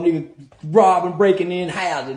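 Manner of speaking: normal talking voice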